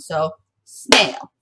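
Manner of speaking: snail as one firm beat; one-beat demo. Shown as a demonstration. Teacher shows snail is one syllable. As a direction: land snail on a single beat. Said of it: "only makes one sound".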